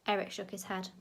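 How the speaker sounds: plain reading voice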